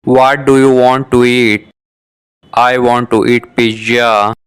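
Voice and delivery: usual speaking voice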